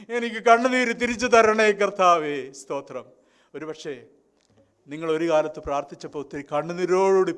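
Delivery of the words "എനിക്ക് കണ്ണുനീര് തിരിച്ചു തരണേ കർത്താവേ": fervent prayer. Retold or imitated. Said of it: "വീണ്ടും ദൈവത്തോട് പ്രാർത്ഥിച്ചു".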